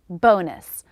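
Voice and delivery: ordinary talk, nothing marked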